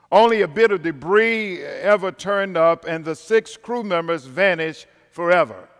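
dramatic storytelling voice, building tension; suspenseful crime show narrator. Acted. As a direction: tell it like a true crime documentary. Dramatic retelling of a shipwreck.

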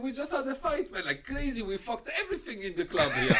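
high, laughing retold brag; laughing mock boast. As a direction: retell the brag through laughter.

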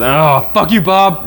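high, loud playful comeback; mock indignation. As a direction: act out an offended retort. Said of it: "She should have said".

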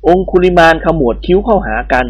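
ordinary delivery

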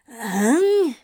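a startled, puzzled huh; confused. A narrator's reading. Crowley baffled by Anthony's warning, confused huh. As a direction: caught off guard, blurting it out.

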